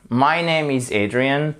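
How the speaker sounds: "My name is Adrian" slow, tune falling; falling intonation. Shown as a demonstration. Teacher models a statement, "My name is Adrian" falling. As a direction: let "My name is Adrian" descend to the end. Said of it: "intonation descendante".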